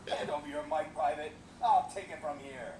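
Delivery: ordinary talk, nothing marked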